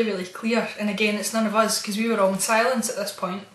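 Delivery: ordinary talk, nothing marked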